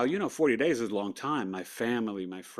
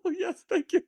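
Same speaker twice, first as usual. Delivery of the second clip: mock grateful patient. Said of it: "And I'm like".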